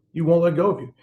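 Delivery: normal talking voice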